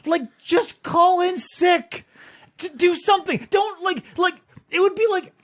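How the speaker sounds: high-pitched incredulous pleading; high, exasperated protest; exasperated disbelief. Real, not acted. Exasperated at teacher returning to school.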